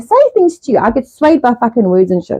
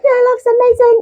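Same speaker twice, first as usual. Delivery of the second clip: mock cheerful. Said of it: "Other days like".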